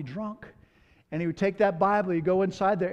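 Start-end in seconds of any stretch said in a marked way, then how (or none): none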